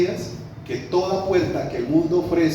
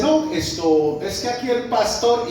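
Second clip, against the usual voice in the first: sarcastic; 6 semitones higher, slower than usual. Retold or imitated. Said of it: "toda sarcástica".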